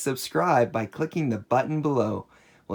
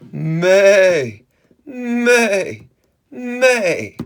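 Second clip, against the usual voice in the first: loud, high, drawn-out meh; goat bleat; 7 semitones higher, 11 dB louder, much slower than usual. Pretend. Answering what a goat says, loud high bleating. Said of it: "The goat says".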